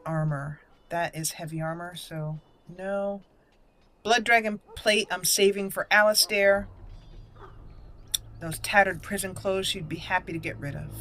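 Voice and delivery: ordinary talk, nothing marked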